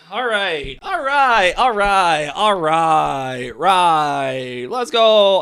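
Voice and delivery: rally yourself before the next play; pumping himself up. Real, not acted. Fan hyping himself up for a comeback, loud urgent chant.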